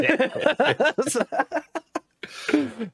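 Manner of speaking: high, laughing okay. Retold or imitated. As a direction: give in through a laugh. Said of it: "It's like".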